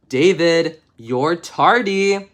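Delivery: loud, slow scolding; teacher scolding. Acted. Teacher scolds David for being tardy, loud and slow. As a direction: call him out for being late.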